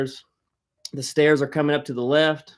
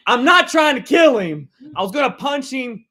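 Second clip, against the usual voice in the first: higher and louder, insisting; excited protest; 9 semitones higher, 5 dB louder than usual. Real, not acted. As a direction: protest with amusement. Defending himself, still laughing.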